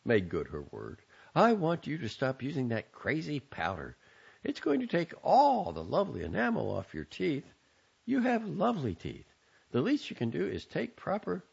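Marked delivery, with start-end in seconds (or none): 1.4-11.4 high, fretful mother's voice; mother nagging her grown son through the curtain; fussing mother. A narrator's reading. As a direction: nag him about his teeth, pitched well up. Mother scolds son about toothpaste.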